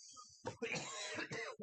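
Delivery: high squeal of laughter. Real, not acted. Laughing after being hit.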